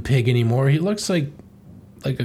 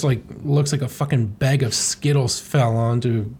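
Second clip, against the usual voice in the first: ordinary talk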